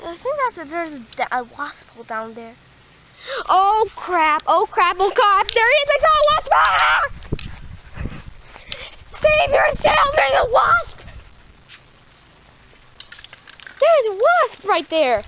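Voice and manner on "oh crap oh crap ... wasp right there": panicked. Real, not acted. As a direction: yell about the wasp. Spots a wasp.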